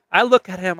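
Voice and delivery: ordinary talk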